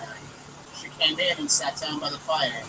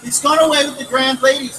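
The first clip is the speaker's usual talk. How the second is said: put-on higher, louder tearful child; blubbering; 9 semitones higher, 8 dB louder than usual. Little girl telling her mother, mildly blubbering. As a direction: blurt it through sobs. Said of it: "blubbered the little girl".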